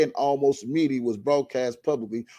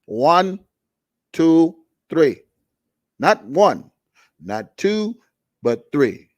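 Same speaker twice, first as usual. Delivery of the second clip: louder, punching each number; loud emphatic count; 3 semitones higher, 6 dB louder, slower than usual. Real, not acted. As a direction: bang out the count in English.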